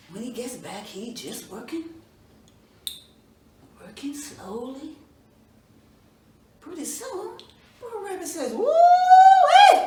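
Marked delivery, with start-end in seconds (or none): 3.8-5.0 whispered. A narrator's reading. Describes slow lazy work. 8.5-9.8 a high, loud whoop of woo-wee; high loud woo-wee cry. Acted. Rabbit whoops to the farmer, high loud cry.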